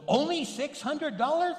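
high-pitched mock amazement; astonished question. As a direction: jump up high in delighted disbelief. Man astonished at the low price.

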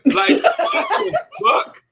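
high laughing what the fuck, drawn out slowly; cracking up in shock at the line; laughing disbelief. Real, not acted. Cracking up at the diss line, laughing in disbelief.

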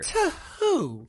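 high-pitched, incredulous comeback; affronted squawk of a question; indignant challenge. Acted. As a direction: throw it back sharply, voice way up. Argument over who is superior.

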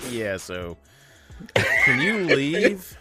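mock dismissive voice. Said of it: "He was like".

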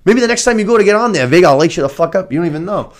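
scolding. Pretend. Scolding Aemon character.

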